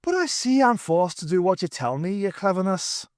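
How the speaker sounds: high, self-pitying humble plea; humble Irishman. A narrator's reading. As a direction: grovel in mock modesty. Pat resigned.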